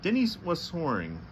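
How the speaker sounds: ordinary delivery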